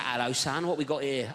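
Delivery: a hint of a South East London geezer. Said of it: "He's like".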